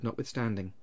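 ordinary delivery, nothing marked

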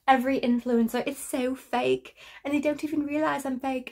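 a light put-on British accent. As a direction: play a snooty Brit scolding an influencer. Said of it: "I became British in that".

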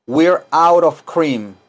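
very slow, words drawn out; slow model sentence. Shown as a demonstration. Teacher models slowly for class to repeat.

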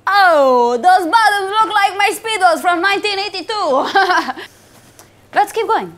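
lightly put-on Italian accent; broken English with an Italian lilt. Italian pasta boss scouting a model, Italian accent.

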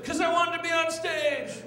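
very high, hammed-up confession; mock an attention-seeking audience member; high-pitched heckler comeback; imitated heckler. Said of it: "people were just yelling out".

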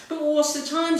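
a hint of a policewoman; imitating the policewoman. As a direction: mimic the woken officer. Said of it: "she says".

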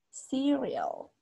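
Cereal stretched out as SEE-ree-ol; English pronunciation. Shown as a demonstration. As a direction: model Cereal slowly for learners. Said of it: "Así es la pronunciación".

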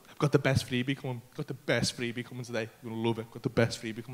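low, hushed mischievous teasing; mischievous partner. Retold or imitated. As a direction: imitate a partner quietly pleased with a secret. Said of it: "like, a mischievous way about it".

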